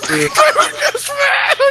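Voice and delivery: high, cracking laughter on mad; laughing repeat. Real, not acted. Cohost mocking the guest's line while laughing. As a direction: squeal it through laughter.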